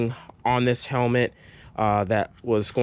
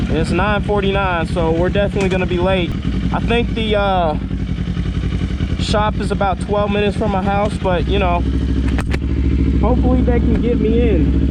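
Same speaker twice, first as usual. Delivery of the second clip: raised voice inside a helmet; talking loud through a helmet mic; 5 semitones higher, 5 dB louder than usual. Real, not acted. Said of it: "I hope you can hear me".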